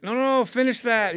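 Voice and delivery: shouting at the screen to finish; eager urging. Retold or imitated. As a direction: beg him to keep going. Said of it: "And I was like".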